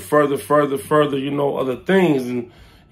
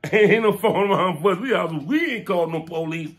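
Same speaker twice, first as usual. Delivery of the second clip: ordinary delivery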